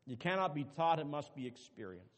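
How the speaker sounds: ordinary delivery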